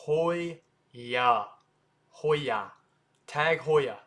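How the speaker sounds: Heuer broken as hoy, yah, then Tag Heuer as tag HOY-ah; AH ending on Tag Heuer; correct pronunciation. Shown as a demonstration. Teacher breaks it down, correct pronunciation. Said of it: "that sounds a little weird".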